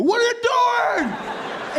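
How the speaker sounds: very high, drawn-out yell; stretched outraged screech; mock yell. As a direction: yell it in hammed-up disbelief. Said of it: "They just look at you and go".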